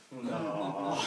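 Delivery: teacher's deadpan no. Retold or imitated. Teacher's flat rebuttal.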